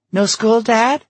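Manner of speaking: put-on high, loud girlish question; eager daughter. Daughter eagerly asks her father.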